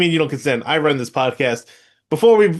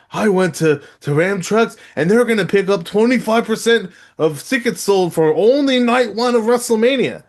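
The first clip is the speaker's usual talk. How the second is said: lightly mocking pitchman, rising higher; mock groveling; 5 semitones higher than usual. Host mimicking the promo for trucks and tickets, mock groveling. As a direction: imitate a groveling plug, pitch climbing. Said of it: "basically on his knees groveling".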